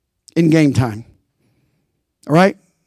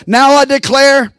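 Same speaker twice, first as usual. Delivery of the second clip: slow, louder prophetic declaration; proclaiming; 7 semitones higher, 4 dB louder, much slower than usual. Acted. Preacher proclaiming God's word to the congregation, loud. Reads prophecy at full force.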